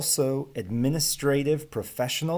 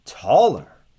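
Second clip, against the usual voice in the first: surprised; 9 dB louder than usual. Acted. Hans repeats customer's wish.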